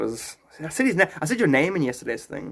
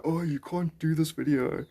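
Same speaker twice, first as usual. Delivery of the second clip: mocking quote; 4 dB quieter than usual. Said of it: "make people go".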